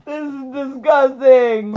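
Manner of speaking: laughing disgust. Real, not acted. Reacting to the pepper.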